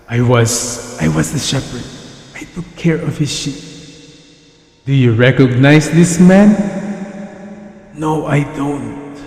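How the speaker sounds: put-on quiet, fearful old servant; hushed, reluctant old herdsman; timid old shepherd.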